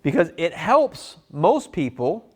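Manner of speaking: slow and deliberate, stretching "helps"; slow demo line. Shown as a demonstration. Salesman showing the pitch to the customer, slow and deliberate. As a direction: linger on "helps". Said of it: "and then when I say".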